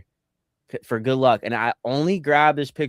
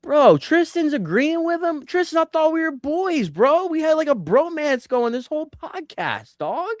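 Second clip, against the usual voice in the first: high, whiny outrage at his cohost; mock betrayal. Real, not acted. Host whining that his cohost sided with the caller, mock betrayed. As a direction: play up the hurt like a jilted friend.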